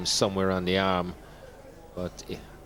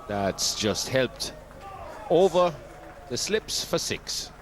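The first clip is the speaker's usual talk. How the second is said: excited commentary call; 5 semitones higher, slower than usual. Real, not acted. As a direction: lift your voice as the ball clears the slips. Six hit over the slips.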